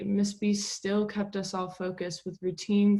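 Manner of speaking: normal talking voice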